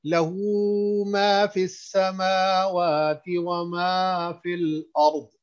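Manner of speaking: slow melodic chant with long vowels; Quran recitation. Shown as a demonstration. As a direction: stretch each vowel in measured recitation. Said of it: "इसको सुने".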